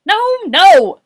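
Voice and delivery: high, loud double no; exasperated cry. A narrator's reading. Boris correcting Morris about his throat, exasperated. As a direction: burst out in frustration.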